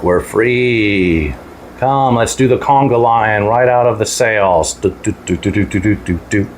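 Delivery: lightly voiced cheerful snail; a happy snail humming a conga. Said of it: "That's what I think a voice of a snail sounds like".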